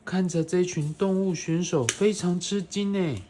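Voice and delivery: put-on bright, animated read-aloud for a small child; reading to a toddler. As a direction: read it like a bedtime picture book. Parent reading picture book to child.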